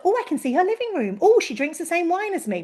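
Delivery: mock-delighted follower; starstruck fan. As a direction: gush like someone thrilled to feel close. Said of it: "people feel a sense of".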